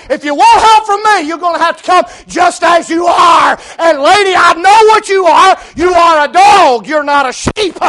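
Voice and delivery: loud, high preaching rebuke; shouted rebuke. Acted. Jesus confronting the woman pleading for help, shouted rebuke. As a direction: build to a full shout as you confront her.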